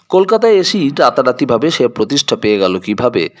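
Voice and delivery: ordinary delivery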